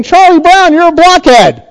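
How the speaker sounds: high-pitched cartoon-style taunt; mock name-calling. Preacher quoting a comic strip insult, mock taunting. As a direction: call it out high, then drop at the end. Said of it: "It's basically saying".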